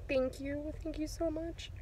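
soft, high, heartfelt thank-you; hushed and lifted, overwhelmed with gratitude; emotional thanks. Real, not acted. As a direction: near tears, thanking them quietly. Overcome with gratitude, near tears.

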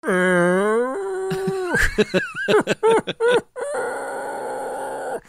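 long high cackle, well above his own voice; brother's laugh. Retold or imitated. Brother laughing at him spitting out the jerky, high cackle. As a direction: mimic a big brother cracking up at him. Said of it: "He's like".

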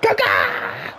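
very high yell of the nickname; celebratory shout. Real, not acted. Gamer cheering his striker, loud shout. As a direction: cheer a player at full voice.

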